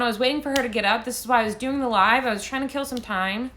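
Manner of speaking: normal talking voice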